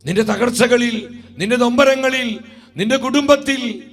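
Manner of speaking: rising, ringing preacher's voice over music; fervent preaching. Acted. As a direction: preach it to the congregation with conviction. Preacher over music, healing service. (ആവേശത്തോടെയുള്ള പ്രസംഗം)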